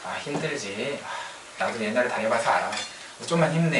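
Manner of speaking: quiet sympathetic murmur; soft comforting. Retold or imitated. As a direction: console a struggling junior softly. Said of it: "그럴 때 우리 어떻게 반응하죠".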